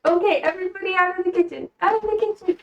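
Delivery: high-pitched pet-directed orders; shooing pets. Real, not acted. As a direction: usher the animals out in a high sing-song.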